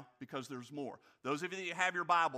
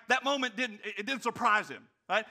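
ordinary delivery

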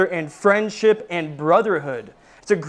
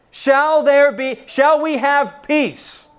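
rising preacher cry; 6 semitones higher, slower than usual. Real, not acted. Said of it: "preacher building the question".

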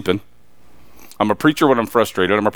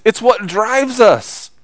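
ordinary talk

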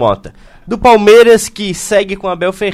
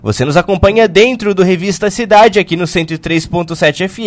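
radio announcer; 4 semitones higher, 5 dB louder than usual. Acted. Radio show opening.

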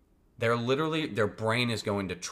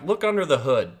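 a bit louder, terse instruction; curt manual voice; 5 semitones higher, 7 dB louder than usual. Retold or imitated. Car manual giving a vague order, mock curt. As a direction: bark it like a useless manual, mildly. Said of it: "it was like".

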